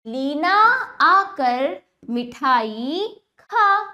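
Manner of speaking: लीना आकर मिठाई खा very slow, projected; word-by-word first reading of Leena आकर मिठाई खा; slow demonstration reading. Shown as a demonstration. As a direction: draw out खा, pausing between words. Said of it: "अब वाक्यों का उच्चारण करते हैं".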